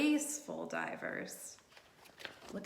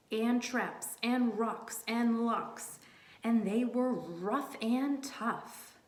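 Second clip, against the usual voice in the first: slow menacing read; slower than usual. Acted. Reading rough hunters.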